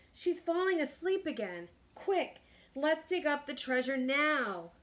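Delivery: usual speaking voice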